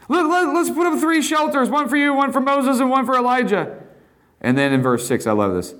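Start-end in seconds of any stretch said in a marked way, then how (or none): 0.1-3.8 high, stammering, eager Peter; babbling Peter. Acted. Peter blurting out his shelter idea to Jesus, high and stammering. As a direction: trip over the words in excitement.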